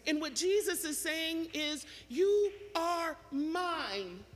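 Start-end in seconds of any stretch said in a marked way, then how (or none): 2.1-4.2 very slow, high "You are mine"; "You", "are", "mine" each held long and separate; emphatic claim. Acted. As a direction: stretch every word through to "mine". Preacher voicing Jesus claiming his sheep.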